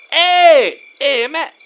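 high, loud hey; calling out. Acted. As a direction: holler for attention at the door. Calling out at the door.

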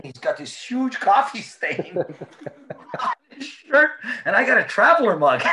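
high and loud, laughter bursting through; cracking up at the coffee stain; laughing. Real, not acted. Recounting Bergie's coffee-stained shirt, cracking up high and loud.

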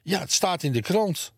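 high, agitated aunt; flustered insistence. A narrator's reading. Flustered aunt insists on newspaper report. (opgewonden, met hoge stem)

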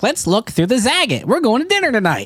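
put-on high-pitched old-lady sketch voice; Farley as old wife. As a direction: play an eager old wife planning dinner. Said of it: "Farley is like".

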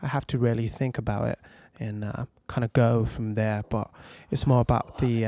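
put-on posh English accent; posh British accent. Shown as a demonstration. Voice actor showing hosts his British accent, posh and eloquent. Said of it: "British accents or anything like that".

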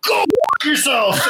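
mock angry yell. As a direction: bark it like an offended crowd. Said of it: "everybody's like".

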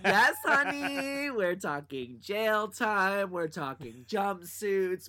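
very slow, sassy, laughing. Retold or imitated. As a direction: stretch every word with sass. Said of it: "And I was like".

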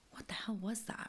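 hushed, voiceless retold reaction; stunned whisper. Retelling a shock at the pay window, stunned whisper. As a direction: whisper it in mock disbelief. Stunned aside as object flies out.

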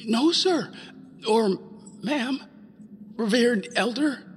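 high, timid croaking voice; scared boy stammering politely; nervous croak. Acted. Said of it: "croaked out".

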